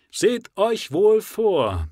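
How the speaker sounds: high, loud zealous leader; rally the exiles with burning zeal; fiery preacher. Acted. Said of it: "mit steigendem Eifer".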